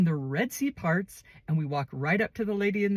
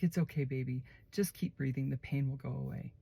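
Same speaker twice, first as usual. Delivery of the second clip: soft, gentle, hushed reassurance; calm her down like a baby; soothing comfort; 3 semitones lower, 7 dB quieter than usual. Retold or imitated. Cellmate comforting crying newcomer.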